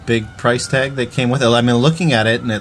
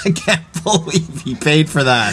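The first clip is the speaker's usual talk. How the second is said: slow, higher mocking through laughter; mock giggle at a buyer; giggling seller; 6 semitones higher, slower than usual. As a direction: snicker at the sucker who paid. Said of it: "giggling to his buddy saying".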